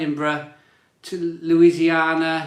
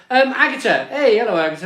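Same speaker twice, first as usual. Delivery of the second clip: higher and louder hello; cheery greeting; 6 semitones higher, 6 dB louder, faster than usual. Real, not acted. Host welcoming Agatha to the stream, cheerful. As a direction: greet her like a regular walking in.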